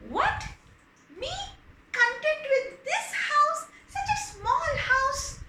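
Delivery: high, loud indignant shriek; a furious wife threatening; rising to a high yell; outraged wife. Acted. Wife's outraged quarrel.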